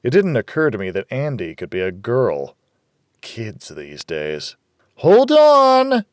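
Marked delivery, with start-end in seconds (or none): 5.0-6.0 very high, loud stagey call; theatrical yell. A narrator's reading. Rita yelling theatrically through the door, very high and loud. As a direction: put on a big cheery yell. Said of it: "Rita yelled theatrically".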